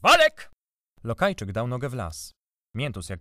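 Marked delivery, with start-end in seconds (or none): 0.0-0.5 sudden loud, high shout; whip-crack shout. A narrator's reading. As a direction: fire the name off like a whip. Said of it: "jak z bicza wystrzelił".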